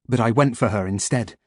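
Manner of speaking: ordinary delivery, nothing marked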